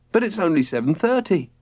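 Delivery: a kid objecting, lightly voiced; protesting boy. A narrator's reading. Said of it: "protested Robert".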